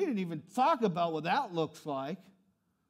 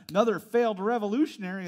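ordinary talk, nothing marked

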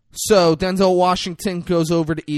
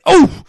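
loud, high yelp of a man hit by a bullet; pained cry; 15 dB louder than usual. Retold or imitated. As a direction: cry out as if just shot. Shot man cries out in retelling.

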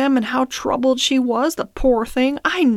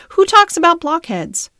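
indignant repeat; 8 semitones higher, 5 dB louder than usual. Acted. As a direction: question pushed up high and loud, stress on talks. Merrylegs repeats the question, louder.

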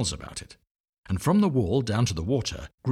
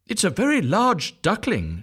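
high, louder surprised mother; puzzled mother duck; 11 semitones higher, 4 dB louder than usual. A narrator's reading. Mother duck staring at the ugly duckling, high and loud. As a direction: exclaim over the huge chick. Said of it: "she said".

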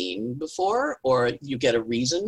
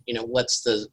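usual speaking voice

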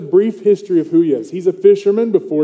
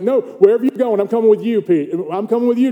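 higher, emphatic retold insistence; insistent protest; 5 semitones higher, 4 dB louder, faster than usual. Disciple vows to follow his teacher anywhere, insistent protest. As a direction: protest eagerly as an acted Peter. Preacher voices Peter insisting to Jesus.

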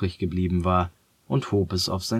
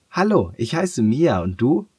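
put-on higher, louder girl's greeting; bright girl voice; 5 semitones higher, 4 dB louder than usual. A narrator's reading. Girl Mia greets Leo at his bedside, higher girl voice. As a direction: greet the boy as a friendly young girl.

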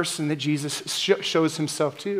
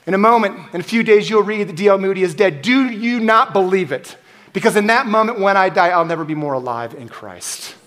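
higher and louder throughout; fervent preaching; 4 semitones higher, 4 dB louder than usual. Acted. Preacher quoting D L Moody, fervent and loud. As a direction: proclaim it with fire.

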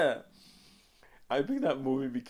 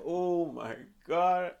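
slow, drawn-out, higher oh my God; stunned oh my God; 7 semitones higher, 4 dB louder, much slower than usual. Retold or imitated. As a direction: drag it out in disbelief. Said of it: "my friend and I were like".

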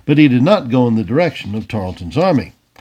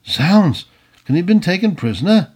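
higher-pitched, startled exclamation and question; startled outburst; 6 semitones higher than usual. A narrator's reading. Colonel realizing the charger never arrived, startled. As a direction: burst out in sudden alarm.

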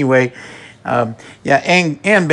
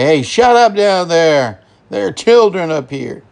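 raised, higher-pitched imitation of a yelling neighbor; mock neighbor yell; 6 semitones higher, 3 dB louder than usual. Neighbor yelling back at Mel about children upstairs, mock yell. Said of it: "the other neighbor wants to yell back".

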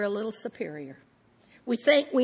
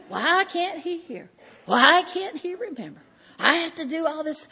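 higher, louder exasperated whine; whiny complaint; 7 semitones higher, 6 dB louder than usual. Retold or imitated. Wife complaining about husband, high exasperated whine. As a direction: complain as if wronged.

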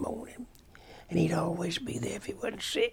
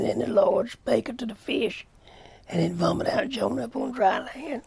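a raised, louder voice lifting the verse out; scripture reading; 5 semitones higher, 5 dB louder than usual. Acted. Preacher reading scripture to his church, raised and ringing. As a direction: read the Bible verse up to the whole congregation.

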